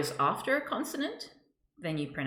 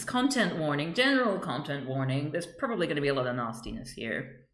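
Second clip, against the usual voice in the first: usual speaking voice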